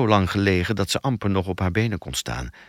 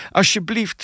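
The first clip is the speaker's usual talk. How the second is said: high-pitched plea; pleading; 11 semitones higher than usual. A narrator's reading. Tearful captive begging to be let out, pleading. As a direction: beg in a much higher voice. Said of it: "smeekte ze".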